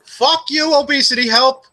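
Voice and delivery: loud, high-pitched mock rage; mock outburst. Podcaster cursing a forum as a joke, mock outrage. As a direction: blast them in playful fury. Mock rant, just kidding after.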